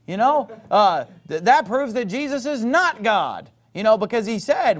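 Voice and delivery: sneering mimicry of a Jehovah's Witness; mocking opponent voice. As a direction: ham up the know-it-all who thinks he's won. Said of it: "I've had people point to that and say".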